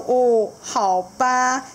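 very slow, high sulky okay; baby seahorse agreeing unwillingly, slow and high; reluctant baby seahorse. Acted. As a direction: drag it out, giving in. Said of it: "他就说".

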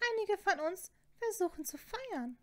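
high-pitched scolding matron voice; indignant Fat Lady. A narrator's reading. Portrait telling off two noisy students, high and indignant. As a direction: put on a high, affronted voice.

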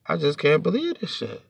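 usual speaking voice

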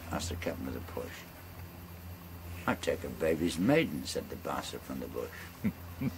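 rolling bush-poem recital, relishing every line; ballad recitation. Acted. Storyteller reciting a bawdy ballad to friends, in a rolling recital voice.

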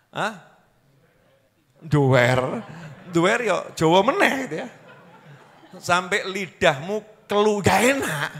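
ordinary talk

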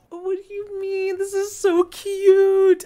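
high, drawn-out squeal; high-pitched gush of delight; gushing over cuteness. Real, not acted. Gushing over something cute.

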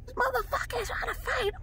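very high, soft falsetto screech; mock shriek at reduced volume; squealed imitation of a furious bride; imitated screaming wife. Wife screaming about the fight.

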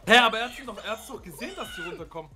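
ordinary delivery

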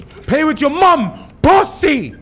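loud, slow, high yell at the voice; yelled insult. Real, not acted. Streamer yelling back at the game's creepy voice, loud.

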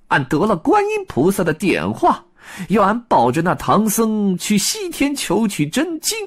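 high Monkey King, indignant huff; high Monkey King voice, resentful; aggrieved Monkey King. Acted. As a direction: grumble about being sent away. Wukong complains of Tang Seng.

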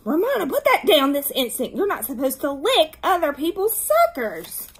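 angry scolding. A narrator's reading. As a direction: scold a little sister at full voice. Beezus scolds Ramona, Wayne demands.

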